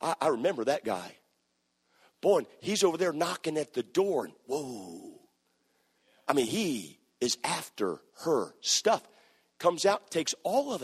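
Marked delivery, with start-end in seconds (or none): 0.0-9.0 lightly voiced nosy neighbor, hushed; small town busybody. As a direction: gossip it over the fence, low. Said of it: "looks over and says".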